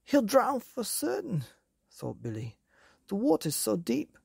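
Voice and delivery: put-on high worried boy voice; fearful young boy; frightened boy. A narrator's reading.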